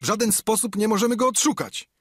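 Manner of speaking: high, loud and fast; hurried shout. A narrator's reading. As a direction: rush out the bad news. Odpowiedział tamten. (szybki, głośny okrzyk pirata)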